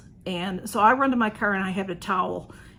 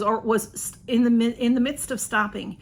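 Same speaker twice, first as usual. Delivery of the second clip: ordinary talk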